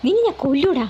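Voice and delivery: a voiced mother's angry outburst; angry threat. A narrator's reading. Mother raising a broom at her son, furious.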